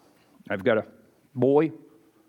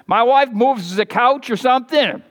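ordinary talk

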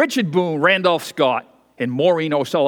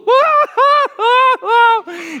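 a high, loud hammed-up wailing laugh; mock hysterical shriek; shrill mock wail; 6 dB louder than usual. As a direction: imitate a woman falling apart, shrieking. Imitating hysterical actress.

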